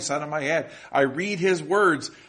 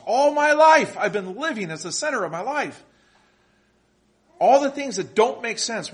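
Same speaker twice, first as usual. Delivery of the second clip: preaching loud; 8 semitones higher, 5 dB louder than usual. Real, not acted. Preacher driving point home.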